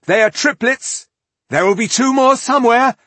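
urgent high-pitched, loud shout; shouted warning. A narrator's reading. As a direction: warn the whole group at full voice. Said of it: "she shouted to the men and women".

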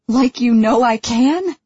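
high, loud, wounded question; hurt. Acted. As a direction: let the hurt break through. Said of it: "unsettled by the hurt in my voice".

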